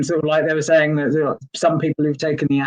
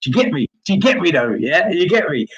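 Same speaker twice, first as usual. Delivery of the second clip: East London slang; 3 semitones higher than usual. Retold or imitated. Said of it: "I just sounded like that".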